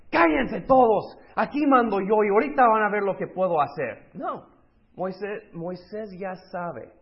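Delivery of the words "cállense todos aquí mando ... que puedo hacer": high, loud tyrant bellowing orders; bossy leader shout. Pretend. Imagined strong leader silencing the grumbling crowd, loud shout. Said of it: "yo pensé que un líder fuerte decía".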